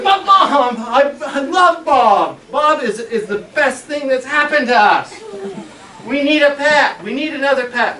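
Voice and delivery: high, loud begging; pleading. Retold or imitated. Boy begging his mother to keep Bob, loud pleading. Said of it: "And I said".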